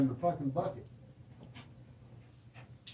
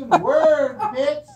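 shout; 10 semitones higher, 13 dB louder, much slower than usual. Real, not acted. Drunken taunting yell.

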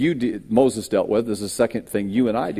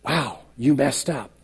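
voice of the enemy; 4 dB quieter than usual. Retold or imitated. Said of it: "that thing that says".

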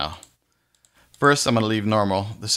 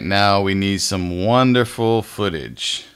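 usual speaking voice

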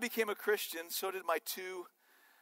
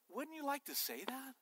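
soft, rising question, pitched up; hushed question; 5 semitones higher, 12 dB quieter than usual. Real, not acted. Preacher puts a question to the congregation, softly. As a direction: ask it softly, well below full voice. Asks congregation, then repeats it louder.

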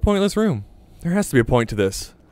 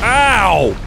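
pained cry; 8 semitones higher, 6 dB louder than usual. Real, not acted. Hit hard by enemy.

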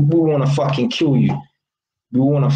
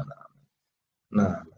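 ordinary delivery

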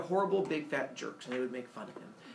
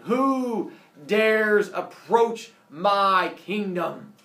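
very slow, loud and booming; booming god voice; 4 semitones higher, 9 dB louder, much slower than usual. Acted. Sun god challenging an intruder, booming. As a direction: boom it out, stretching each word majestically. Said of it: "hears a voice come booming out".

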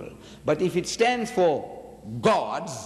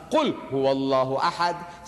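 rapid recited Arabic, in a voice unlike his own; Quranic recitation; 3 semitones lower, much faster than usual. Retold or imitated. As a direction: rattle off the verse as a quick recitation.

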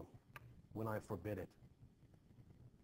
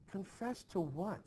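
alarmed question; 8 semitones higher than usual. Acted. Alarmed at confession news.

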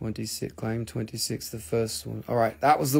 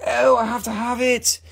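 high, pleading mock customer; pitched-up imitation of a desperate buyer; eager buyer voice; 9 semitones higher, 3 dB louder than usual. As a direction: beg for the crystal in a high whine. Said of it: "somebody will be like".

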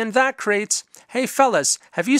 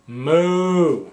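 cow moo. Pretend. Cow noises in a picture book.

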